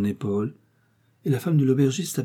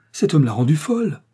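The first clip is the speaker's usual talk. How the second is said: higher, louder, appalled; horrified; 5 semitones higher, 5 dB louder than usual. A narrator's reading. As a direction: blurt out the horror. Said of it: "s'écria Darrell".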